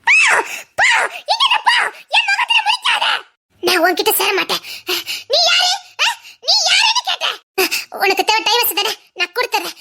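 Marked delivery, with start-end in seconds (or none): 0.1-3.1 shrill high scream, pushing him away; hysterical shout. Acted. Distraught daughter screams at father. 5.3-7.4 high, cracked yell of who are you; wife screaming at the husband she no longer knows; hysterical demand. Acted. Distraught woman confronts husband.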